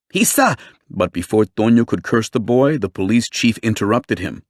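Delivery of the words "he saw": shrill angry. A narrator's reading. Tonio starting to curse.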